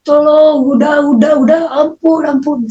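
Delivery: high, pleading cry; acted-out desperate yell, fading at the end; re-enacted scream for help. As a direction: cry out for mercy as if being beaten. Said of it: "Saya teriak".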